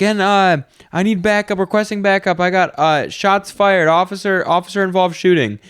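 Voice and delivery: high, urgent mock police dispatch call; panicked cop radio call. Roleplaying cop calling in shots fired, urgent and high. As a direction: call for backup like an officer after a shooting. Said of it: "it's like watching a real video".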